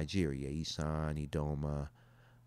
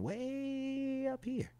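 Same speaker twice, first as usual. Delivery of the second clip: slow, high drawn-out amazement; high and slow, marvelling at the map; amazed; 5 dB quieter, much slower than usual. Real, not acted. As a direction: stretch it up high in wonder. Reading map, surprised at Norway.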